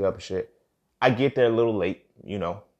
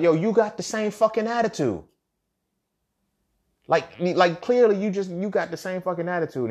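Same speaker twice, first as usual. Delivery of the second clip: much higher, exasperated pitch; retold indignant accusation; 8 semitones higher than usual. Turning attitude remark back on classmate, high and indignant. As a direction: throw the accusation back at them.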